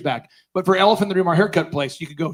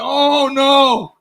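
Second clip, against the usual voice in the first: long, higher "oh no"; mock dread; 4 semitones higher, 3 dB louder than usual. Speaker spotting the dreaded store, mock dread. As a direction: draw it out in comic alarm.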